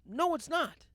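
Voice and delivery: forceful rebuttal; emphatic denial. Real, not acted. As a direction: shut the claim down hard. Rebutting the favorite claim.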